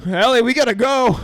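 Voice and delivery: high loud hurry; mock panicky urgency. Retelling Mike fleeing in the van, panicky call. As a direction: rush it out in a panic. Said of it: "Mike's like".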